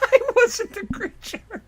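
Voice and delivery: laughing mock falsetto; mocking quote. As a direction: mimic him in a silly squeak. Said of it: "Technically true".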